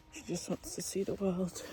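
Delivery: ordinary talk, nothing marked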